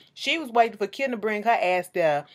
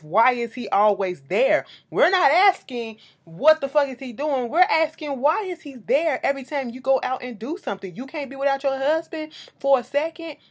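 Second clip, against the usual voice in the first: loud, raised-pitch emphatic questioning; exasperated insistence; 5 semitones higher, 5 dB louder than usual. Real, not acted. Challenging Kim over her ever-present husband, exasperated and loud. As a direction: hammer the question home, loud and high.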